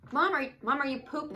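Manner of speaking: put-on high and loud, a nosy little kid; loud toddler. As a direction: call out high like a curious toddler. Toddler asking loudly in bathroom.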